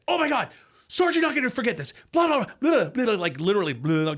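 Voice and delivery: high, excited babbling; gushing. Retold or imitated. New hire gushing at the boss who just hired him, high excited babble.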